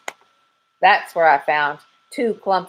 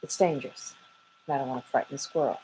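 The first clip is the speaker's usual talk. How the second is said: soft, low-volume secret; hushed and hurried; lowered voice; 4 semitones lower, 11 dB quieter, much faster than usual. A narrator's reading. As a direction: hush it so the squirrel won't hear, quick on the end. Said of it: "lowering her voice".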